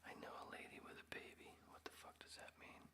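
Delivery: fast, breathless whisper. Real, not acted. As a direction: hush it out quickly. Rereading comment in whisper.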